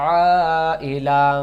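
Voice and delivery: long madd recitation; four-count stretch. Shown as a demonstration. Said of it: "এই যে চার আলিফ".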